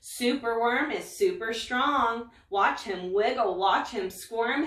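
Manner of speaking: usual speaking voice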